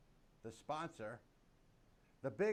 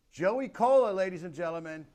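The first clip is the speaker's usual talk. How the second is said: big game-show announcer call, much higher and louder; announcer intro; 5 semitones higher, 14 dB louder than usual. Acted. Host bellows the guest's name to the audience, game-show announcer.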